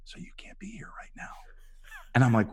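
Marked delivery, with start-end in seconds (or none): 0.0-1.3 urgent secretive whisper; imitated hush-hush spoiler. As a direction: whisper it right into someone's ear. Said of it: "he whispers in my ear".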